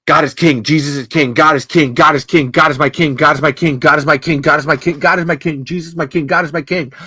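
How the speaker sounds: loud, insistent retold rant on repeat; imitating mother's screaming. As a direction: hammer it out relentlessly, imitating someone yelling in your face. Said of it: "yelling and screaming in my face".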